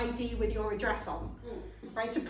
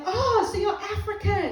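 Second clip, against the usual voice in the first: higher, louder astonished question; mock surprise; 8 semitones higher, 5 dB louder than usual. One guy in the minibus questioning her, mock surprise. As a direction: act out a man's amazed discovery.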